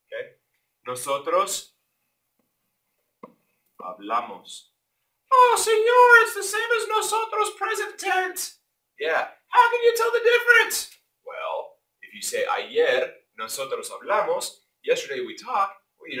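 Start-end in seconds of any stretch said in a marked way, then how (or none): 5.3-11.0 very high shouting mock student; objecting student. Teacher mimics a student protesting the tense is the same, high and loud. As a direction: squeal like a confused student. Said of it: "student objecting in class".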